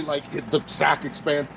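usual speaking voice

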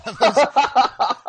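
talking through laughter; host cracking up at the kicker joke, laughing. Real, not acted. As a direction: laugh as you speak. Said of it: "laughing at kicker joke".

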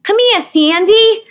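put-on high, slow, loud kid Brooklyn accent. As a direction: belt it like a Brooklyn kid Annie. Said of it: "tape out there of me being like".

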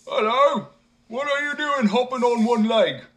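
high, loud hail; calling out. A narrator's reading. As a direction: shout down from the ship. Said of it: "calling out".

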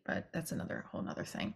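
quick unvoiced whisper; whispered aside. Real, not acted. Vlogger's quick hushed aside about her show, whispering.

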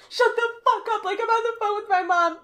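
high, loud irritated retort; mock angry snap. Imitating another girl snapping over a phone call. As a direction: snap at the intruder. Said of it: "any other girl would be like".

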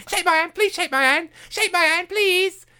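very high, whiny mock plea; desperate begging. Mocking a coach chasing superior people's hands, desperate high begging. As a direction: beg in a silly high voice, over and over. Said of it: "desperately trying to shake superior people's hand".

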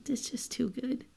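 breathless whisper. Real, not acted. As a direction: whisper it, overcome. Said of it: "overwhelmed by the story".

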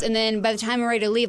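normal talking voice

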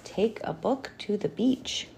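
hushed, unvoiced reading; whisper. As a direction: whisper the sign aloud. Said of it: "Ooh, now the room says".

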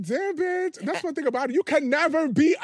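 high, loud, sassy clapback; sassy comeback. Retold or imitated. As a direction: toss it off like a diva. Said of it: "I'm like".

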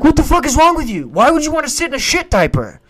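put-on high, loud yell at a toddler; exasperated yelling. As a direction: lose it at the kid, loud and high. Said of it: "I'd be like".

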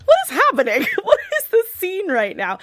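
high, loud, laughing bafflement; incredulous giggling voice; laughing disbelief. Retold or imitated. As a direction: laugh through the confusion. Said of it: "And I was like".

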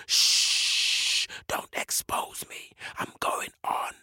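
slow, secretive whisper; long shush, then hushed whispering; hushing whisper. Acted. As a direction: whisper it like someone afraid of being given away. Warrior hushes the birds again.

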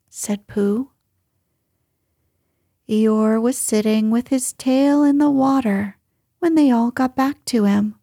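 usual speaking voice

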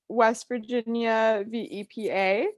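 words stretched through a yawn. Real, not acted. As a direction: yawn mid-sentence while talking. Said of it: "your cadence with you yawning is so funny".

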